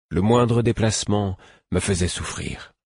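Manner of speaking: ordinary delivery, nothing marked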